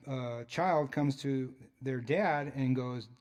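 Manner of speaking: ordinary delivery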